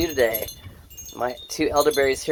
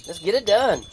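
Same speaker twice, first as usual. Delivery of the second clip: put-on higher, playful kid voice hurrying mom along; lightly voiced child nagging; daughter urging mom; 10 semitones higher than usual. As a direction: mimic the impatient kid calling Mom to work. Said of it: "She's like".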